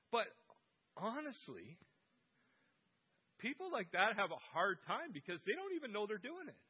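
ordinary talk

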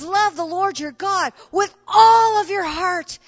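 a shouted command that breaks into tears; crying shout. Acted. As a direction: cry it out with all your heart. Quoting Jesus at the peak.

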